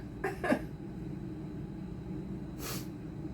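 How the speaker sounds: long airless wheeze of a laugh; silent laugh. Real, not acted. Cook overcome by onions, breathless laugh.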